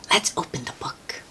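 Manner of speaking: soft fast whisper to the little listener. Real, not acted. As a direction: whisper it like a secret before the story. Cozy read-aloud, opening the book.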